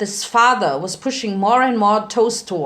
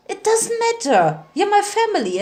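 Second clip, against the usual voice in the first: put-on much higher, pleading young voice; eager plea; 11 semitones higher than usual. A narrator's reading. Spike begging his father to accept him, high and earnest. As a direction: insist with rising childlike urgency.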